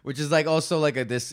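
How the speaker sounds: ordinary talk, nothing marked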